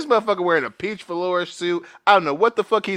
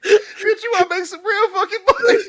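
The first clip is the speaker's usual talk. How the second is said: hustler pitch. Pretend. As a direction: go way up and lose it laughing mid-pitch. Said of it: "seventies car salesman outfit".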